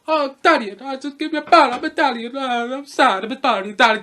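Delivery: garbled mock-Italian accent; Jared Leto. Reviewer sending up an actor's performance, fake Italian accent. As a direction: babble it in a bad, overdone Italian accent. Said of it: "going back on Jared Leto's performance".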